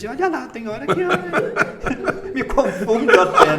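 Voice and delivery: laughing as he jokes, voice jumping up; laughing while teasing about mixing up languages; joking laugh. Real, not acted. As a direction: break into real laughter mid-joke. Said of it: "Brinquei, né?".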